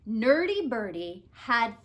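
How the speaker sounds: plain reading voice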